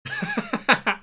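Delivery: squeaky high giggle; high-pitched laugh. Real, not acted. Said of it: "That's what I sound like in the shower".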